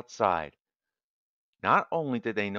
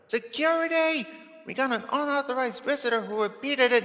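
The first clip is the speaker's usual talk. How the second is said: high, raised-pitch alarm call; announcer calling security; 14 semitones higher than usual. Acted. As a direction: summon the guards in a high voice.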